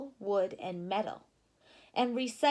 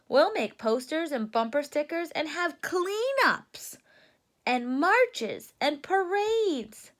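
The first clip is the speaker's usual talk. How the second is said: excited Freddie; higher and louder, bursting with the idea; thrilled cub rattling off plans; 6 semitones higher, 5 dB louder than usual. Acted.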